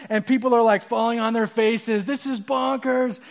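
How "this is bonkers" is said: high, drawn-out amazement; slow, pitched-up crowd disbelief; astonished crowd. Acted. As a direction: marvel as a stunned onlooker. Astonished crowd voiced in story.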